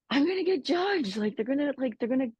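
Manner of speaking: high, fast worried voice; anxious inner voice. Retold or imitated. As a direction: voice your own panicked thoughts. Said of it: "for me, it was like".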